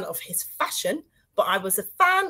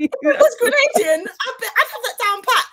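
high, loud, laughing as she talks; laughing protest; 13 semitones higher, 6 dB louder than usual. Real, not acted. Host excusing her bad Bajan, laughing protest. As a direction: protest through real laughter. Laughing over failed accent attempt.

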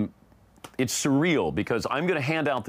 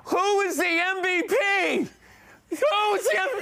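frantic retold yelling. Reenacting his podium panic, frantic high shout. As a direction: yell it desperately over a noisy crowd. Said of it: "so I'm yelling".

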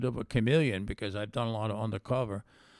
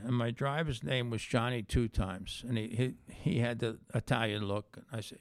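ordinary talk, nothing marked

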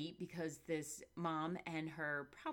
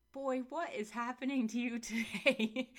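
higher, louder exasperated mom voice; 6 semitones higher, 5 dB louder than usual. Retold or imitated. Mom questioning her bouncing son in the grocery store, exasperated and amused. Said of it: "she's like".

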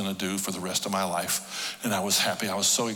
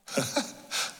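breathy laugh; 9 semitones higher, 5 dB quieter than usual. Retold or imitated. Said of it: "I laughed out loud".

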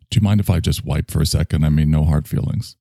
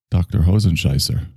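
normal talking voice

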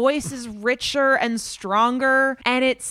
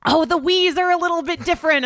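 high, fast mock enthusiast voice; nerdy know-it-all; 8 semitones higher, 4 dB louder, faster than usual. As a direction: play the smug superfan spotting tiny differences. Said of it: "being able to go".

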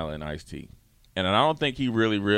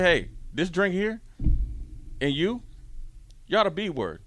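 mock scolding; 9 semitones higher than usual. Friend scolding the bartender.

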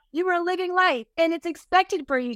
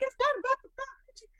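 high, thin wail, softer, a mock sob in the voice; whiny crying protest; 11 semitones higher, 6 dB quieter than usual. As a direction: whine it like a tearful kid. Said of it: "I'm like".

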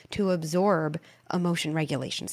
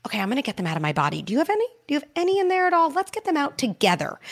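put-on much higher, quick, playful coaxing voice to a child; 8 semitones higher, 3 dB louder, much faster than usual. Shown as a demonstration. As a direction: turn it into a silly game for the kid.

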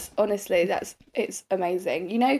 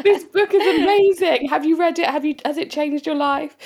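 high, loud American impression; American accent; 6 semitones higher, 5 dB louder than usual. Guy raving about the book by the pool, American accent. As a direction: gush in a put-on American accent, pitched way up. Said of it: "this is my American accent".

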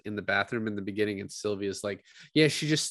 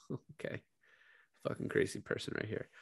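whispered aside; 9 dB quieter, faster than usual. Real, not acted. Hushed aside after Sal quote.

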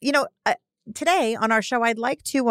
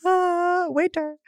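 higher, fussy call for the waiter; mock fancy diner; 12 semitones higher, much slower than usual. Co-host joins the bit, calling a waiter in a mock snooty voice. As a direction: summon the waiter like a snooty guest.

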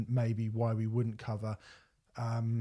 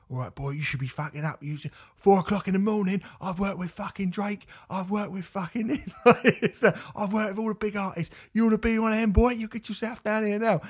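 higher, louder mock East End gangster; East End gangster Troy; 10 semitones higher, 5 dB louder than usual. Podcaster imitating the agent phoning the rapper, loud mock gangster. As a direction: ham up a ludicrous cockney thug bossing him around. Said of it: "like, East End gangster thug character".

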